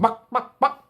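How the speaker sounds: high, loud clucking hen; bright hen noises. Acted.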